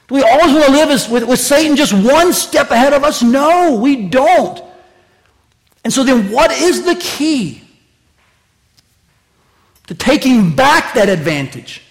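high, loud pulpit crescendo; impassioned preaching. Real, not acted. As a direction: drive the question home, loud and high. Preacher builds to full force.

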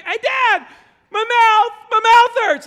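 high, loud mock kid wail; child calling out. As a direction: imitate a kid yelling for Dad at night. Kid waking dad, acted.

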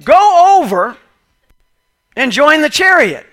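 high, loud, commanding call; the Spirit sends Philip to the chariot, loudly commanding; Spirit's command. A narrator's reading. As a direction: urge him on with a ringing order. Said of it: "the Spirit said to Philip".

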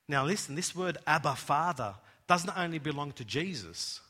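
ordinary talk

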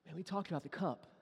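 soft, quiet, tender plea; Jesus gently reminding Peter; gentle reminder. Retold or imitated. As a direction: lean in and remind him softly. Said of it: "He's like".

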